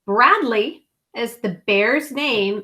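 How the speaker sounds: ordinary delivery, nothing marked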